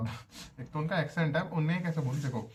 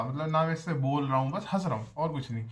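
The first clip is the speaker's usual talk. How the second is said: ordinary talk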